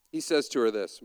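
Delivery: ordinary delivery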